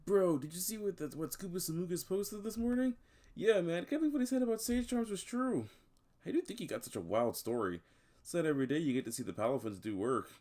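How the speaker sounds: high-pitched, hyped chatter; excited gossiping bystander. Acted. Game master voices gossiping bystanders.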